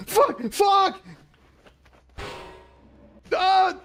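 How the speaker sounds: high, cracking curse screams; terrified yelling. Real, not acted. Streamer caught by aliens, yelling in panic. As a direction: yell it out in fright. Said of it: "I screamed that".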